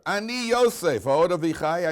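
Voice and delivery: high, loud, dramatic declaration; full-voiced Hebrew cry of revelation; Yosef's revelation. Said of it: "Yosef says to his brother".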